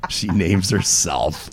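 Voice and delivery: low, slow, softened repetition; slow mock disbelief. Real, not acted. Host reacting to co-host naming herself, slow mock disbelief. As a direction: say it slowly and low, drawn out.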